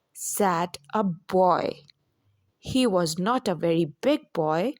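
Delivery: ordinary delivery, nothing marked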